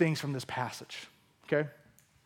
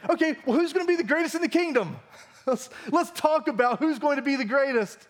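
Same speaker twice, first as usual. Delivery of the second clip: higher, fast and giddy, with a laugh; hammed-up squabbling disciples; mock eager disciples; 7 semitones higher than usual. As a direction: play the clueless disciples asking who's greatest. Disciples mocked, eager and clueless.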